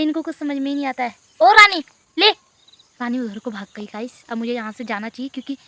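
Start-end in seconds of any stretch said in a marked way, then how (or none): 1.4-2.3 high, loud call; calling the cat. Real, not acted. Girl calls Rani with a treat, loud high call. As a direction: shout a treat offer to the cat.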